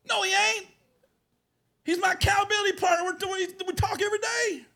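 indignant denial. Retold or imitated. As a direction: shout it down in disbelief. Pastor denies it on the phone.

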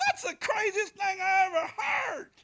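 incredulous laughing retort; laughing disbelief. Retold or imitated. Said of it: "I was like".

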